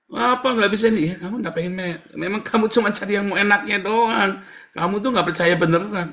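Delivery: loud, high, exasperated telling-off; mock scolding. Preacher's inner reply to someone seeking prayer, acted scolding. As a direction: scold them as if fed up with excuses.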